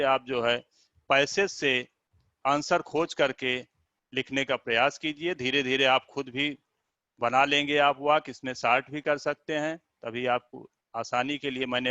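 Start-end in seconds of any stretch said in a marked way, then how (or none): none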